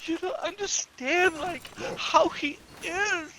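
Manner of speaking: high, slow whiny sob act; mock crying. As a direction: imitate a dramatic, tearful complaint. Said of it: "he was all crying and shit".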